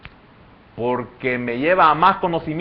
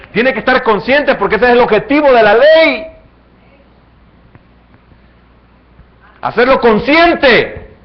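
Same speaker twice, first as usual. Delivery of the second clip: rising, louder sermon build; preaching at full force; 8 semitones higher, 10 dB louder than usual. Acted. Preacher driving the point home to the congregation, loud and rising.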